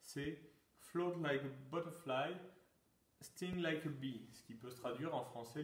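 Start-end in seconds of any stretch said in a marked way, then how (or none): 0.8-4.3 Muhammad Ali's motto in French-accented English, the end slowed. Shown as a demonstration. French quiz host quoting Ali in French-accented English. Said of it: "désolé pour mon accent".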